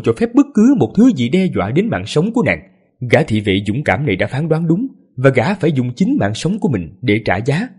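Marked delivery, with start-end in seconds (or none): none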